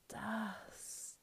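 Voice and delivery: high, soft creature hiss. A narrator's reading. Creature clawing at the ashes of the path, hissing. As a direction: draw it out in a whispery hiss. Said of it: "he hissed".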